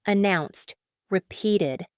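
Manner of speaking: ordinary delivery, nothing marked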